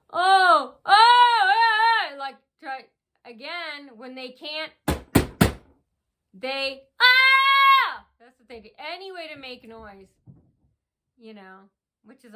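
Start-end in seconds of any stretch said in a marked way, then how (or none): 0.1-2.2 high, loud mock wailing oh's; mock screaming. Imitating the stalkers screaming to make noise. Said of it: "they start screaming". 7.0-8.0 sudden high, loud fake shriek; mock shriek. Imitating a stalker screaming at the bank.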